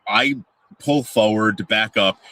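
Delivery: ordinary talk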